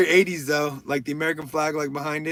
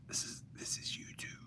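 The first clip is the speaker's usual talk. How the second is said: whisper; 22 dB quieter than usual. Acted. Whispered aside to viewers.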